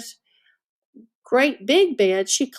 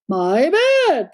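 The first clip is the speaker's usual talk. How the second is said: a hint of a sputter; lightly sputtered outrage; sputtering Papa Bear; 3 semitones higher than usual. A narrator's reading. Papa Bear finds his bed used, mild sputter. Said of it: "Papa Bear sputtered".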